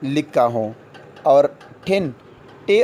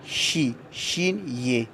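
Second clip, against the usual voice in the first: spelled out slowly; 7 dB quieter, much slower than usual. Shown as a demonstration. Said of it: "یہ کی آواز دیتے ہیں".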